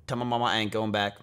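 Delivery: ordinary talk, nothing marked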